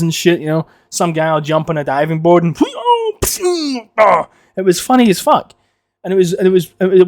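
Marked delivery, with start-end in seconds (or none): 2.6-4.2 high mouth-made springboard and splash sounds; cartoon sound effects by mouth; imitated slapstick noises. Diving board mishap on a clip show, high silly noises.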